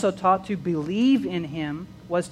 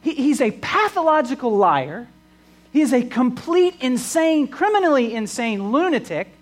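higher and louder, heated preaching; impassioned outburst; 6 semitones higher, 6 dB louder than usual. Real, not acted. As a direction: thunder the critics' verdict. Preacher voices critics' verdict.

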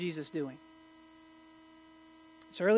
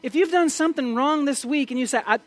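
louder and higher, impassioned pulpit voice; full-force preaching; 6 semitones higher, 6 dB louder than usual. Acted. Preacher pleading with the congregation about guilt: impassioned, loud. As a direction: preach it out, rising to a cry.

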